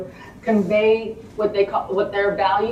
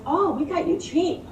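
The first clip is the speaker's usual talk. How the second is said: dean's casual reply; 8 semitones higher than usual. Retold or imitated. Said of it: "And she goes".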